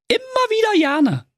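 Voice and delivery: very high, piping voice; squeaky she-hedgehog. Acted. She-hedgehog happy to help again, squeakily cheerful. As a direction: chirp it in a tiny high voice.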